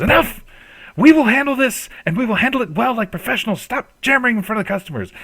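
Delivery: high, loud order that cuts them off; commanding shout. Acted. Grandmother yelling at her daughter to stop, loud. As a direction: shut the argument down. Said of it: "almost screaming at each other".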